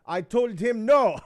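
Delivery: high, loud and slow, a mock stern wife; mimicking his wife. Husband mimics his wife vetoing the robot, high and loud. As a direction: have the wife shut the idea down flat, high and loud.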